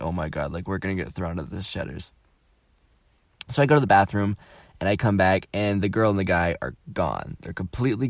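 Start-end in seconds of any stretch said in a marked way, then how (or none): 0.0-2.1 low, hushed aside of dread; retold dismay. Speaker sees a drink spill at dinner, quiet retold dismay. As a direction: drop it low and soft, imitating private dismay.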